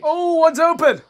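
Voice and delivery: high, loud burst of excitement; excited exclamation. Real, not acted. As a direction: shout the discovery. Finds open window in game.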